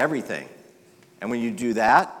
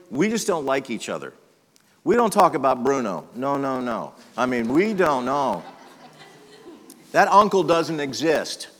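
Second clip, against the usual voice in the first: higher, louder voice of a family in denial; mock family denial; 3 semitones higher, 5 dB louder than usual. Pastor mimicking families hiding secrets, higher mock denial. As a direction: play the family brushing it off.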